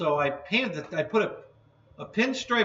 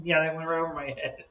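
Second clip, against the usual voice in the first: ordinary talk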